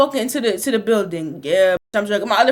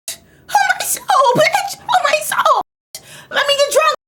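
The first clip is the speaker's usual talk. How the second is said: put-on drunk high rant; 13 semitones higher, 4 dB louder than usual.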